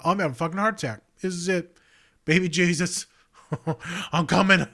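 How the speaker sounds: mock dying theatrics, loud and high; dramatic. Retelling his own panic with over-the-top drama. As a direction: ham up a fake heart attack. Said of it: "so dramatic".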